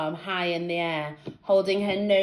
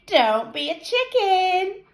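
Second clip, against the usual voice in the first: put-on girl voice; 13 semitones higher, slower than usual. A narrator's reading. Friend urging Colleen.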